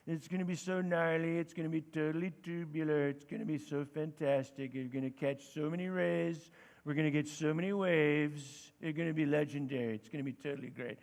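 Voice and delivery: low, relaxed stoner-surfer voice; California surfer dude. Acted. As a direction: mellow out like a surfer who's been smoking. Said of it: "California surfer dude who's been smoking".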